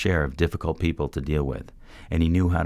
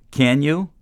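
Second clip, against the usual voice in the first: much higher, louder demanding question; 12 semitones higher, 6 dB louder than usual. A narrator's reading. Mentor pushing the man for an answer, insistent. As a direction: force him to answer. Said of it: "demanding another answer".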